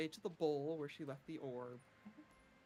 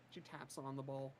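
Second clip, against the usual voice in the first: ordinary talk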